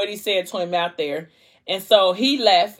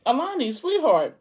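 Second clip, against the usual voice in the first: doting professor; 7 semitones higher than usual. Retold or imitated. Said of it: "the professor was like".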